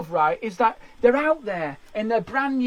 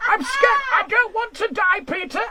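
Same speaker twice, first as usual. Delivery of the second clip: hammed-up terrified reporter; 8 semitones higher than usual. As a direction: overplay panic for the camera. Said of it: "This is my impression of someone".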